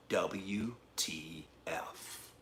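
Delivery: slow, soft, spelled-out letters; near-whispered slow punchline; deadpan hushed aside. Real, not acted. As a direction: drop low and draw out each letter. Punchline of weight loss story.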